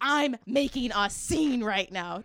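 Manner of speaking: loud, drawn-out announcement; retold screaming. Shopper tells friend on the phone, retold loud declaration. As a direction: re-enact it loud and slow. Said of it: "I'm just screaming the F word".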